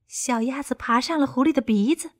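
ordinary delivery

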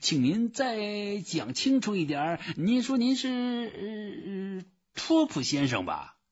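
high, put-on clerk voice; high-voiced bumbling official; puzzled phone clerk. A narrator's reading. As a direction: fumble for the caller's name, high-pitched. Confused clerk asks for the name.

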